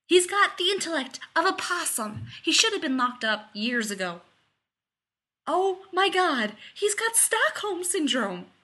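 high, loud indignation; exasperated rant. Acted. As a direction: rant on, stretching the words in disbelief. Rodney's exasperated rant resumes.

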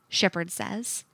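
usual speaking voice

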